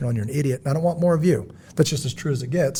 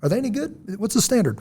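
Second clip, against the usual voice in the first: high, soft questioning voice, much higher than usual; skeptical questioner; 7 semitones higher, 5 dB quieter than usual. Retold or imitated. A boss quizzing an outside expert, higher and softer. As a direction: ask it like a doubtful boss checking up. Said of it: "bring in a guy like myself and say".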